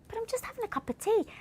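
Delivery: high, fast plaintive protest; pleading innocence. Retold or imitated. Wife retelling her protest to her husband about tea. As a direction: plead like you did nothing wrong.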